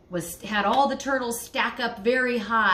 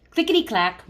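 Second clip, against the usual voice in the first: put-on lively read-aloud voice for kids; storybook reading; 3 semitones lower, 4 dB louder than usual. Teacher reading a picture book to her class, lively.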